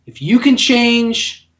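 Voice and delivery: rousing ringside speech, slow and building; Rocky's victory speech. Acted. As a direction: build to a big mock-heroic shout. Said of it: "and then saying".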